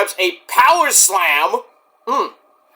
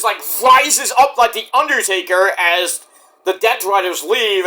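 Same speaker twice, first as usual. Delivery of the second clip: ordinary talk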